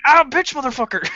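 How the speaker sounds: high pained mock curse; mock yelp of pain. Shocked by static on a cold day, cursing in a high mock yelp. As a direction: yelp the curse like you just got zapped.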